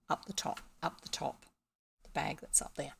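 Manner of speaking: soft whispered repeat to herself; whispered aside. Real, not acted. Pointing the viewers at the bag, a murmured whisper. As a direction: murmur it under your breath. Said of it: "repeats quietly to herself".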